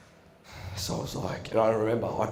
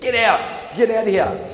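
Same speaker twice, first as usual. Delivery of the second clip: higher, louder, shouted eviction; angry get out; 8 semitones higher, 6 dB louder than usual. Retold or imitated. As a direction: order him out of the hall. Principal ejecting him.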